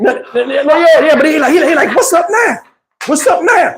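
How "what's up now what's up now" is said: high taunt through laughter; laughing mock taunt. Teller laughing as he retells Mo's taunt. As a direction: yell it while cracking up.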